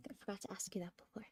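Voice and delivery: quick hushed aside; whispering. Real, not acted. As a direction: whisper it under your breath.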